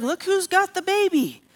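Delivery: high-pitched mocking taunt; lightly acted sing-out of one-upmanship. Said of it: "and she's mocking".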